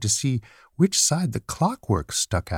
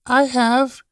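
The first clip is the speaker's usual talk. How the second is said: high, louder protest; little rabbit's cry; 14 semitones higher, 6 dB louder than usual. A narrator's reading. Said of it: "cried the little rabbit".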